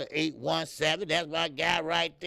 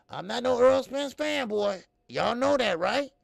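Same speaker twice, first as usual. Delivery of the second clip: insistent; 9 semitones higher, 8 dB louder than usual. Real, not acted. Insisting to chat.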